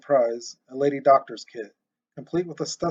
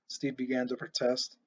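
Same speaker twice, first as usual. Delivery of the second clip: ordinary delivery, nothing marked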